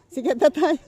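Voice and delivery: laughing tease. Real, not acted. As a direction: crow it through real laughter. Joking they are famous, laughing. (natatawang panunukso)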